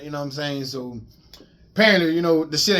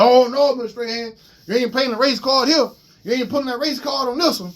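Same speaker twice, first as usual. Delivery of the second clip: cop voice; 7 semitones higher, 3 dB louder than usual. Retold or imitated. Cop's voice retold in story.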